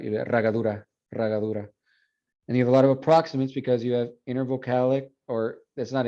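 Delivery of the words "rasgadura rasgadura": Chilean way, "ragadura" said with the s dropped. Shown as a demonstration. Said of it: "Or if you're in Chile, you just cut it out".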